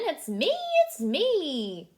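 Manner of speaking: high, loud hen voice. Acted. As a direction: cluck it out like a hen. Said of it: "says the hen".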